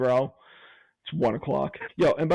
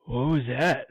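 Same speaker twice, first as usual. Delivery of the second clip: retold shout; slower than usual. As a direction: shout it over at the two of them. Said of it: "He goes".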